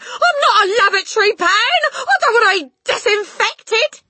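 very high, loud protest; indignant cry. A narrator's reading. Husband protesting to wife, indignant panicked cry. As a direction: wail in outrage. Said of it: "the husband cried".

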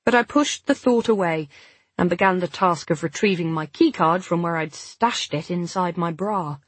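usual speaking voice

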